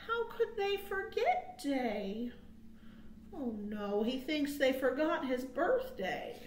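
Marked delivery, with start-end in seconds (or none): none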